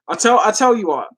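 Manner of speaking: ordinary talk, nothing marked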